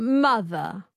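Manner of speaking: transatlantic Mother with no final r; transatlantic accent. Shown as a demonstration. Learner echoing the coach, transatlantic accent. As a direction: copy a non-rhotic Mother. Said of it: "That's really good".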